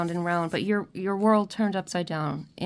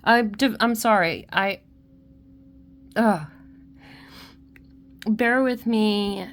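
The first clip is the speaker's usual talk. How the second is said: louder, broken apology while crying; 4 dB louder than usual. Real, not acted. Reader to her viewers, crying and asking patience. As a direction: gulp back a sob and apologise. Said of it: "made me cry".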